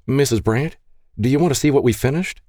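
a hint of an eager adolescent; eager boy asking. A narrator's reading. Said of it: "the pastor's oldest son asked".

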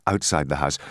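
usual speaking voice

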